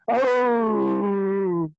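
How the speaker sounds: high startled oh; shocked gasp. Acted. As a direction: cry out in shock. Gnoll finds the body.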